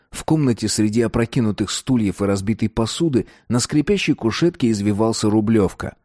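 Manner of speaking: plain reading voice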